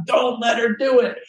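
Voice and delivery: high, urgent voices in the head; panicked inner voices. Retold or imitated. Inner voices warning not to hand over the book, panicked.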